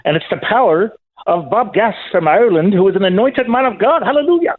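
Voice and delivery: playful Irish revivalist, pitch rising steadily; Irish accent. Pretend. Caller praising Bob Gass from Ireland, rising Irish preacher accent.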